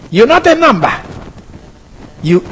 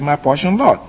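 quiet; 4 semitones lower, 6 dB quieter than usual. Shown as a demonstration. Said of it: "He just said".

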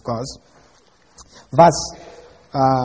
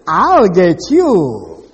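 slow, loud bossy wife; 2 semitones higher, 10 dB louder, much slower than usual. Acted. Jezebel promising the vineyard.

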